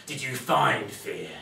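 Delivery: slow, drawn-out question; a tired captain hoping for once; weary captain. Acted. Captain asks slowly.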